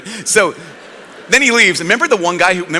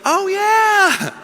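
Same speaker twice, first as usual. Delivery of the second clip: very high, airy remembering; mock realisation; 12 semitones higher than usual. Apostles imagined remembering the prediction, mock realisation. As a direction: float it up as if it just clicked.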